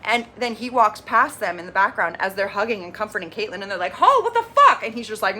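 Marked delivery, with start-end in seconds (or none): 3.9-4.8 high, loud retold call-out; mock outraged yell. Girls call out the man who dumped their friend, mock outraged yell. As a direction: yell it like the indignant friends, hammed up. Retells girls calling out to Hall.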